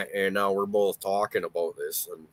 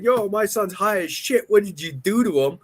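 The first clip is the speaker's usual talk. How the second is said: high, louder mock frantic mom; imitating the mom; 11 semitones higher, 5 dB louder than usual. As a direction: ham up a panicked mother calling. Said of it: "be like".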